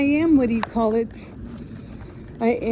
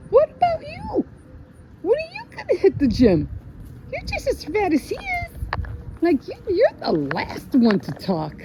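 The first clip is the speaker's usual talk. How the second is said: indignant rant; 11 semitones higher than usual. Real, not acted. Vlogger ranting at the governor, high indignant outburst. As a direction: tell him off, voice climbing high.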